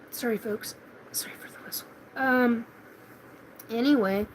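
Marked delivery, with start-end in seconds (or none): 0.1-1.9 soft whispered apology; whispering. Real, not acted. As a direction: drop to a whisper. Apologizing quietly to viewers.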